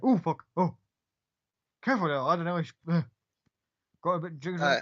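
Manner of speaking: a big soldier jumping at a cat; startled Gith soldier. Acted.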